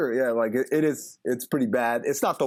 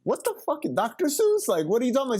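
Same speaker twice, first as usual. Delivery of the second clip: high incredulous squeak, much higher, rising; retelling his own baffled reaction, hammed-up; mock disbelief; 11 semitones higher, faster than usual. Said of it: "I was like".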